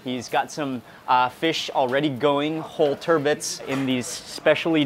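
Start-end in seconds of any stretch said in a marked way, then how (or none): none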